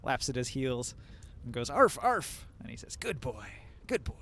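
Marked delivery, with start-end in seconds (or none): 1.7-2.5 high yappy arf arf; barking like a dog. Acted. GM imitates the creature barking. 3.0-4.1 put-on soft, quiet good boy; praising a dog. Cultist praising pet.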